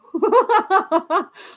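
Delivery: bright real laugh; quick amused laugh. Real, not acted. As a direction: burst out laughing. Laughs at own joke.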